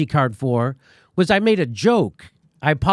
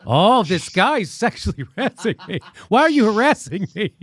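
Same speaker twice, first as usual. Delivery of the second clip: higher, laughing mock of an offended accuser; mocking whiny accusation; 5 semitones higher than usual. Host mimicking his accuser to his audience, mocking and whiny. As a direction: make fun of her complaint through laughter.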